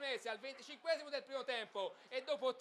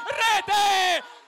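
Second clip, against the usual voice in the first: goal shout; 11 semitones higher, 22 dB louder than usual. Real, not acted. Goal call repeated.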